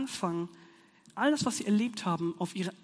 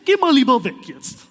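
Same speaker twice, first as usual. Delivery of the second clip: loud, higher taunting of a villain; defiant child; 5 semitones higher, 9 dB louder than usual. Retold or imitated. Child in bed taunting the villain, loud and cocky. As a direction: tell off the bad guy, safe behind your parents. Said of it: "denkt sich das Kind".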